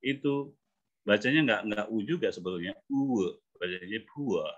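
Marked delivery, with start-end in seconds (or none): 4.1-4.4 POOR in British style as poo-uh, r dropped; British POOR, gliding from oo to uh; British reading. Shown as a demonstration. Coach showing the class a British pronunciation. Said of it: "itu kayak British ya".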